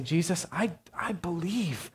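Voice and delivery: a soft, breathy, confessional whisper; whispered prayer. Pretend. A struggling believer whispering honestly to Jesus. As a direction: whisper it as a private admission.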